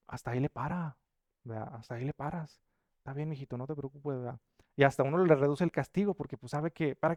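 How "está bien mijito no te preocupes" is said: softer, tender reassurance; gentle father. Retold or imitated. A father forgives his son, soft and tender. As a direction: soothe the child after scolding. Father comforts scolded child.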